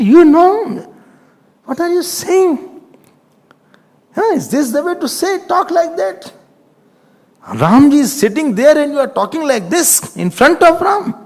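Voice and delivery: high-pitched mock rebuke; acted-out affronted telling-off; indignant scolding. Acts Lakshman indignantly scolding Janak.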